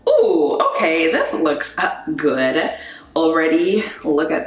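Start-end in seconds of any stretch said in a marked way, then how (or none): none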